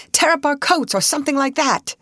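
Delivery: high-pitched, pressing suggestion; urgent cry. A narrator's reading. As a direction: urge them into action. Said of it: "cried Mister Town".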